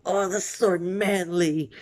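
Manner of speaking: high, slow, admiring tough guy; Kirishima voice. Retold or imitated. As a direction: gush over how manly it is. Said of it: "Kirishima's like".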